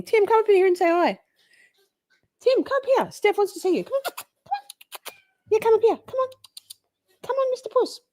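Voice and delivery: high coaxing cat call; sing-song coaxing to the cat. Real, not acted. Calling Tim the cat up, high coaxing.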